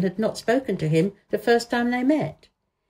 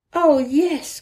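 pitched-up eager reply; eager cry; 8 semitones higher, 4 dB louder than usual. A narrator's reading. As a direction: blurt it out, thrilled. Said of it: "cried Colin, quite flushing".

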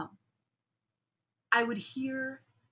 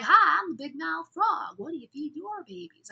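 big mouth frog; 6 semitones higher, 4 dB louder than usual. Acted. Frog quizzes a cow about her babies, big mouth voice. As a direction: open the mouth huge and play the frog. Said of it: "his mouth is really big".

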